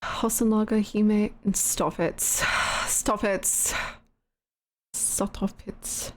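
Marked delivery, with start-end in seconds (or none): 1.5-6.1 fading whisper, getting softer each time; real embarrassed whisper, softer each time; whispered stop it. Real, not acted. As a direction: hiss it under your breath in embarrassment. Embarrassed pleading.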